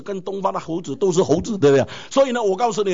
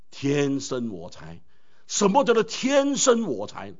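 天生我材 drawn out word by word; slow quote; 5 semitones lower, slower than usual. Shown as a demonstration. Preacher lingering over the proverb, slow. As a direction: slowly savour 天生我材 as a quote. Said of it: "刚才我讲那句话".

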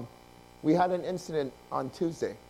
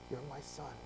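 very soft, hushed correction; God's gentle voice; 4 semitones lower, 17 dB quieter than usual. Retold or imitated. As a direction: speak quietly as a father to his son.

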